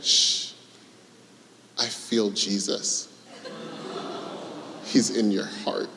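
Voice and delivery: hushed, slow little whisper; toddler's whisper. Retold or imitated. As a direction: whisper it like a sleepy three-year-old. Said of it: "she goes".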